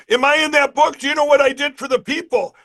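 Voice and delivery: a cop eagerly asking if he made the book; eager retold question.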